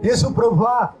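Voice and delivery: preacher leading prayer aloud, phrase by phrase; fervent prayer. Acted. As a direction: pour it out to God with the church. Leads congregation in prayer. (ప్రార్థనలో ఉత్సాహంగా)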